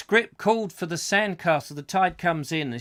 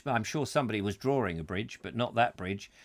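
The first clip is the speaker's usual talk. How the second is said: usual speaking voice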